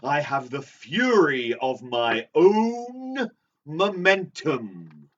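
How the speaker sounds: grand, slow quotation; theatrical declamation. Acted. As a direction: declaim it slowly with swelling emphasis. Theatrically repeating the quoted line.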